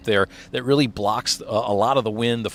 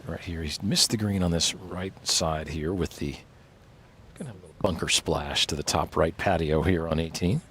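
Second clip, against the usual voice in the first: golf commentator; 5 semitones lower, 6 dB quieter than usual. Acted. Live golf play-by-play.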